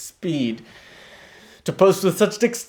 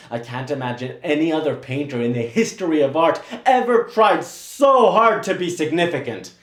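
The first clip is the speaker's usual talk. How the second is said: ordinary delivery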